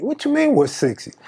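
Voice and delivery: mildly annoyed retort, "mean" pitched high; high start, falling away; a little irritated. Retold or imitated. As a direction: challenge him with slight irritation. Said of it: "I'm getting a little irritated".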